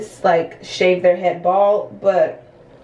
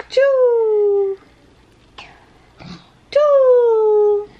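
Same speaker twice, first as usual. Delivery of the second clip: playful choo sound; 13 semitones higher, 7 dB louder than usual. Real, not acted. Playing with toddler.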